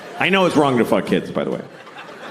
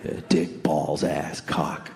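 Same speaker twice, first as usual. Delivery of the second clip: put-on low, hushed old-man voice; low quiet old man; 7 semitones lower, 6 dB quieter than usual. Old man teaching a kid bad words, low and hushed.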